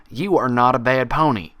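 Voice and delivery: loud, steady insistence; firm reassurance. Acted. Applejack repeating to Pinkie, loud and firm. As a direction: plant it firmly.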